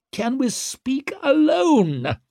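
very high, drawn-out plea; shrill desperation; increasingly shrill. A narrator's reading. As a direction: beg in a shrill, stretched voice. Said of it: "voice was growing increasingly shrill".

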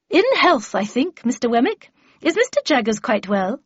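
swooping, high-rising courteous inquiry; lofty Miss Havisham. Acted. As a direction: exchange pleasantries with grand emphasis.

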